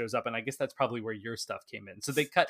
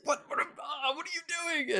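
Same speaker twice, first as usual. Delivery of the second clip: startled butcher; 14 semitones higher than usual. Retold or imitated. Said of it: "the guy's like".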